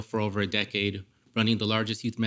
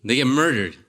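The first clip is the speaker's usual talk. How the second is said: louder retelling of a chilling line; 2 semitones higher, 4 dB louder than usual. Retold or imitated. Storyteller quoting his best friend about the brother's shooting, chilling. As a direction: say it with chilling weight, projected. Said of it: "the way he said it".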